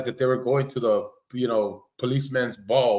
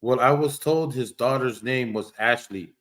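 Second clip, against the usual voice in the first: ordinary talk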